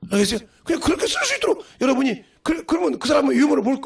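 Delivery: ordinary delivery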